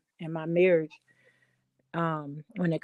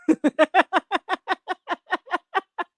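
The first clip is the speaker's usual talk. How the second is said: high loud burst of laughter; real laugh over the story; 9 semitones higher, 4 dB louder than usual. Real, not acted. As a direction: laugh it off, amused. Laughing at husband's tone.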